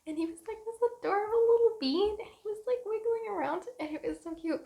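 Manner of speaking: high, tearful-sweet cooing; gushing adoration. Real, not acted. Mother describing her baby on the ultrasound, gushing.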